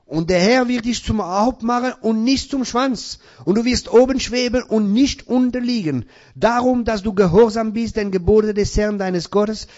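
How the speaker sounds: loud, high preaching cry; fiery sermon proclamation; preaching at full force. Acted. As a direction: proclaim the blessing over the congregation.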